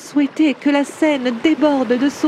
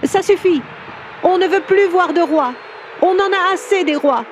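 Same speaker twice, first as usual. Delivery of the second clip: high-pitched cry of revolt; cri de foule; 7 semitones higher than usual. A narrator's reading. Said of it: "on entendait crier". (cri de foule révoltée, voix haut perchée)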